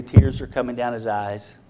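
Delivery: ordinary talk, nothing marked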